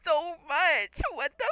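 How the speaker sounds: choked-up sob of a speaker; crying. Real, not acted. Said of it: "So I'm the only one not crying".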